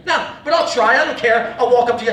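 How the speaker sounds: normal talking voice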